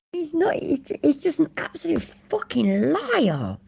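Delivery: high, breathy whispered insult. Real, not acted. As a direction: hiss it up high.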